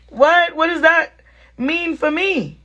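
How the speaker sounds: baffled what. Retold or imitated. To the listeners about his roommate remark, baffled disbelief.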